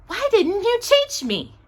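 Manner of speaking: ordinary delivery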